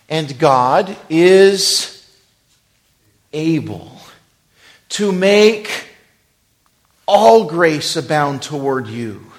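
very slow, loud, high reading. Shown as a demonstration. Preacher reading the verse slowly to the church, loud and high. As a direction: draw each word out. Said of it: "I'm gonna read it slowly".

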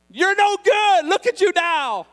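loud, high taunting cry; jeering accusation. Retold or imitated. Voices in a man's head cursing him, loud jeering. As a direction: jeer it out like the tormenting voices. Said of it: "cursing in my head and laughing at me".

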